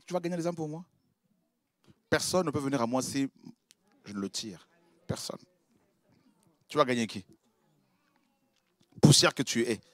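hushed, lightly voiced God; quiet divine rebuke. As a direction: deflate the proud believer softly. God answers the boaster.

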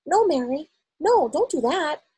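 high, loud, sharp objection; urgent, sharp telling-off; sharp command. A narrator's reading. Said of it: "spoke so sharply".

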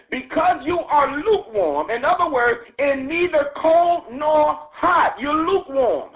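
slow emphatic preaching. Acted. Preacher pressing the lukewarm verse on the church, slow. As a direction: draw it out for weight.

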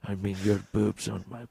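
put-on low, hushed, halting Indian accent; fumbling, nearly whispered Indian-accented caller; Vishnu. Nervous caller muddling his words to operator, hushed Indian accent. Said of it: "an Indian dude on the sex".

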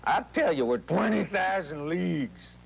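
a hint of the intense leading man; understated Kirk Douglas bravado. Shown as a demonstration. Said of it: "That's Kirk Douglas".

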